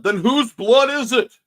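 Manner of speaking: a hint of a shocked paramedic; alarmed question. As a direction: ask it like a startled medic. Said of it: "worst thing to hear in EMS".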